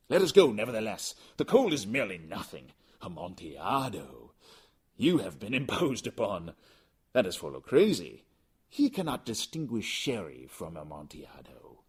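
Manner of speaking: fast, loud dismissive voice; Fortunato, insistent. Acted. Drunken Fortunato insisting they go to the vaults, loud and quick. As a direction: brush the warning aside.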